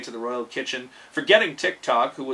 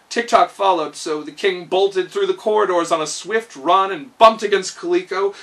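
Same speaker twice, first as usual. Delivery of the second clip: usual speaking voice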